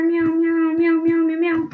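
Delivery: high, sustained run of meows; cat meowing. Pretend. Player chatting to a cat player, cat meowing. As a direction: mew over and over on one high note.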